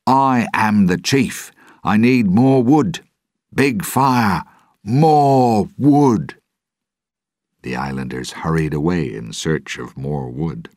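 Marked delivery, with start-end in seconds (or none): 0.1-6.4 loud, high chief's commands; shouted orders. A narrator's reading. Jack as chief sends islanders for wood, shouted. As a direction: bellow orders at a raised pitch. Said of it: "he shouted in the language of the Pelagostos".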